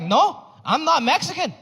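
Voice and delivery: put-on Salvadoran accent. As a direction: correct him in broken English. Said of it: "He was like".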